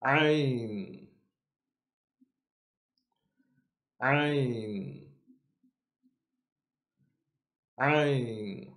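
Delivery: Ain said sharp from the inner throat; correct Ain. Shown as a demonstration. Teacher shows students the correct Ain. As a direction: squeeze Ain from the back of the throat. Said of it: "let's try that again".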